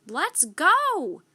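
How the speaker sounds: loud high calling cry; girl calling her brother urgently; cried. A narrator's reading. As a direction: call it from the treehouse window. Annie calling from treehouse.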